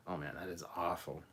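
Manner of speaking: usual speaking voice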